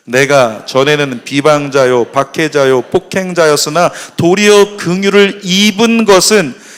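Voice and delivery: slow, loud congregational Bible reading; solemn, projected recitation; scripture reading. Acted. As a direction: lead the verse aloud in measured, drawn-out phrases. Leads scripture reading aloud.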